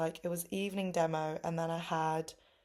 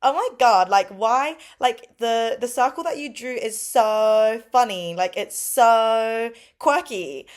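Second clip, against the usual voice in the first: high, loud, excited gushing; gushing coworker; 5 semitones higher, 9 dB louder, slower than usual. Retold or imitated. Coworker gushing over my drawing, high and loud. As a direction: rave about the funny circle. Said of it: "she was like".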